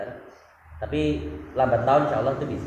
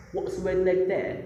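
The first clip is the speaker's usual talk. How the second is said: put-on What's the weather like there said higher, in a polished British accent; British accent imitation; 8 semitones higher than usual. Contrasting how she actually said it, in a British accent. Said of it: "Tapi dia bilangnya".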